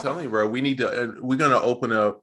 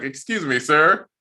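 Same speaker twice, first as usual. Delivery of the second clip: polite salesman voice rising high at the end; telemarketer pitch; 6 semitones higher, 3 dB louder than usual. Pretend. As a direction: sell it like a cold caller.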